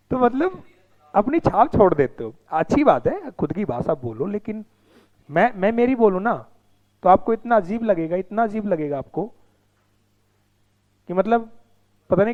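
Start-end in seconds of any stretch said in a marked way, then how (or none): none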